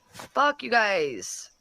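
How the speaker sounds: ordinary delivery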